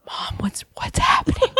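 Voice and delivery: frightened whispered question; scared whisper. Retold or imitated. Kid asking mom while hiding from visitors, whispered in fear. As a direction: whisper it, terrified of being heard.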